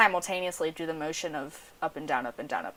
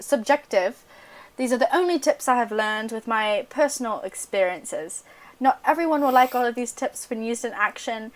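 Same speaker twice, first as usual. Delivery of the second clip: put-on British accent; 3 semitones higher than usual. Reading Madison's tips aloud to co-hosts, mock British accent. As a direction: read the list like a prim English lady. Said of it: "read this with a British accent".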